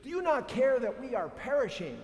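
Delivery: high, loud anguished plea to the teacher; desperate plea. Retold or imitated. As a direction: cry out as if the boat is sinking. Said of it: "they go to him and they say".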